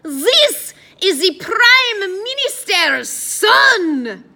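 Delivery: very loud, slow, high French proclamation; exaggerated French accent. A narrator's reading. Addison passing Eddie off to the guard, exaggerated French accent. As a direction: announce it grandly with a hammy French accent. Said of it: "speaking in an exaggerated French accent".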